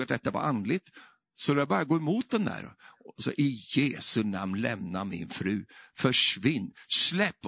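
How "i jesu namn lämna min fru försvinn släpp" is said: normal talking voice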